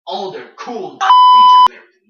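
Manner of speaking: mock the features at full volume; mocking shout. Real, not acted. Gamer yelling scornfully about PlayStation features.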